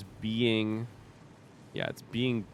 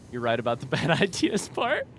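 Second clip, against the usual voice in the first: laughing; 5 semitones higher, 4 dB louder than usual. Real, not acted. Laughing at own joke.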